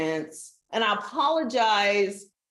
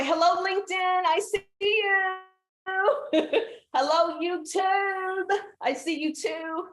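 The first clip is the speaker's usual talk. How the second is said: playful high greeting; 9 semitones higher than usual. Real, not acted. Greeting each platform playfully.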